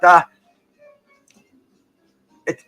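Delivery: ordinary talk, nothing marked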